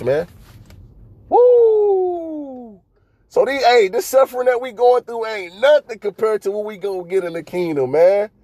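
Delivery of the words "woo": high whooping cry of joy; joyful woo. Real, not acted. Preacher on the road whooping over the promise, excited. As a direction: let out a high happy whoop.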